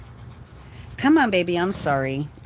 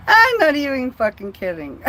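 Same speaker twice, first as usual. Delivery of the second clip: higher and louder, words through real laughter; laughing while talking; 6 semitones higher, 6 dB louder than usual. Real, not acted. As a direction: keep laughing as you insist.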